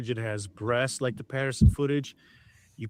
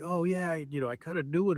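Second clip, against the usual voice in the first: imitated excitement; 5 semitones higher than usual. Host retelling a man's reaction to footage, mildly mimicking his excitement. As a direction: lightly act out someone getting excited. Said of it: "He gets really excited".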